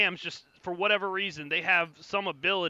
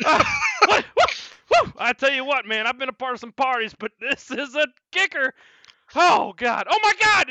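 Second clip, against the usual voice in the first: high, loud whooping; hyped bard; 8 semitones higher, 10 dB louder than usual. Acted. Bard whooping to the party, high and loud.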